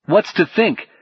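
high, hurt demand; something like rage. Acted. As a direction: demand an answer, wounded and angry. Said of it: "something like rage".

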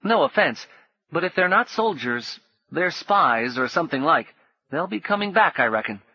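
put-on high cheeky boy; high grinning boyish voice. A narrator's reading. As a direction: tease him with a grin. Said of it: "He grinned at Paul".

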